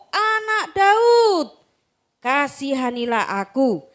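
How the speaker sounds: insistent beggar shouting for mercy. Acted. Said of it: "semakin keras ia berseru".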